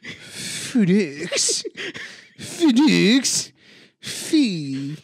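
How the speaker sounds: FedEx said as Fee-diks, slow and rising; comic foreign-accent FedEx; mock Russian accent. Shown as a demonstration. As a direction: draw out FedEx like a Russian speaker. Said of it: "what do we call it".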